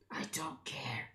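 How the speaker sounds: unvoiced dismissive aside; muttered to herself; whispered aside. Real, not acted. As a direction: brush it off under your breath. Grumbling at a build warning.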